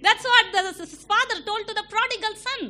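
usual speaking voice

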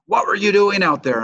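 mock furious. Rival racers confront him in the parking lot, mock furious. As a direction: replay the rivals' furious demand. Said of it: "furious with me".

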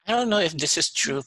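ordinary talk

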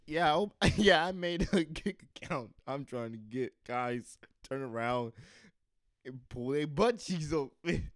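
loud, laughing mock patron, slow at the end; loud laughing overheard brag; overheard customer. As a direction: lay out the boast loud and slow. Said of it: "all she hear is".

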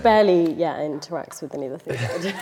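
ordinary talk, nothing marked

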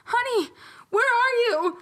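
high, singsong call through the house; stepmom calling out. Acted. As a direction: call for your daughter, high and loud. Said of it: "And she's like".

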